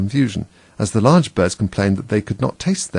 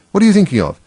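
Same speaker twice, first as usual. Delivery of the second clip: loud, high-pitched scolding; stern telling-off, high and loud; severe; 11 semitones higher, 7 dB louder, faster than usual. A narrator's reading. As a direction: rebuke sharply with raised voice. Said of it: "said the mouse to Alice severely".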